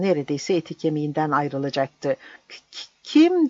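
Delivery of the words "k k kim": high, frightened stammer on the first sound. A narrator's reading. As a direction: stutter out the question in fright. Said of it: "diye kekeledi". (korkuyla kekeleyerek)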